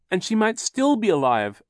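high, loud, hopeful protest; crying out. A narrator's reading. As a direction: cry out urgently. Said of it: "Violet cried".

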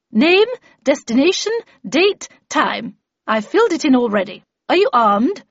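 emphatic, commanding questioning; brisk Miss Havisham figure. Acted. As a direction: rattle off the form like an official, firmly. Narrator voices Miss Havisham's dialogue.

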